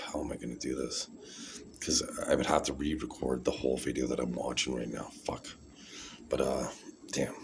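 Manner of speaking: low, soft, frustrated mumbling; muttering to himself. Real, not acted. Vlogger stuck on how to play his video, muttering to himself. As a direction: think aloud under your breath, cursing softly.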